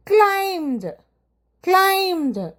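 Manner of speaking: ക്ലൈംഡ് said as klaimd, the b dropped; teacher models ക്ലൈംഡ് with a silent b; silent b climbed. Shown as a demonstration. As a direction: say ക്ലൈംഡ് straight from m to d with no b. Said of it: "ആ ബി silent ആയിട്ടാണ് എപ്പോഴും".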